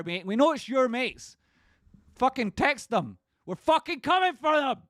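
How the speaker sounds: a real full-throated rant, pitch way up; angry rant. Real, not acted. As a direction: shout it at the doubters, fired up. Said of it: "sorry for getting so angry".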